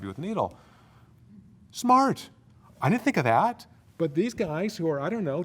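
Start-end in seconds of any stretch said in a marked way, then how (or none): 1.7-3.7 impressed surprise. Real, not acted. Impressed by students' idea.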